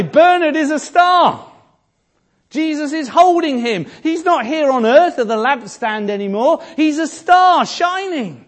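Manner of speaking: high, loud, ringing pulpit delivery; full-force preaching. Acted. Preacher lifting the congregation with Bernard's example, in a loud, high ringing voice. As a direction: preach it out to the whole church.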